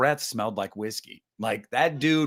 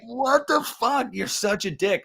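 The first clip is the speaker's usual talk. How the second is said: incredulous; 10 semitones higher than usual. Retold or imitated. Own reaction retold, disbelief.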